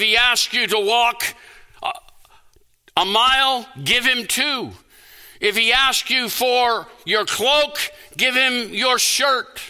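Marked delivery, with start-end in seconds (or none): none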